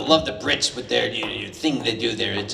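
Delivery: normal talking voice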